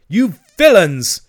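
high, loud, indignant bellow; furious shout. Acted. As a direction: shout it in outrage at the culprits. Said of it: "he shouted".

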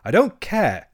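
high, loud stubborn Rat; dogged. A narrator's reading. Rat refusing Toad's plan, dogged. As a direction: dig in firmly. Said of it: "said the rat doggedly".

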